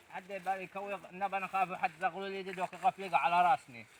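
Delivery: soft worried mutter; fearful murmur. Acted. Thief fearing the owner's beating, soft worried murmur. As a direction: afraid of being caught. Fearing Zaghloul while stealing.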